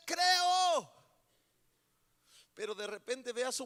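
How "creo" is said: higher, louder cry of a desperate father. Acted. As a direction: cry out the one word in despair. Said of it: "se desespera y dice, clamo".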